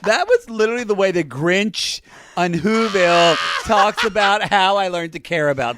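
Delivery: loud, high excited outburst; excited exclaim. Real, not acted. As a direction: blurt it out gleefully. Grinch comparison, loud.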